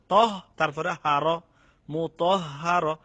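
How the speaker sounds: মুতহহার with ত joined to a doubled হ, slow. Shown as a demonstration. Teacher breaking down tashdid for students, slow and loud. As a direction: stretch the হহ in মুতহহার. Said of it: "ত হারে ধরছে".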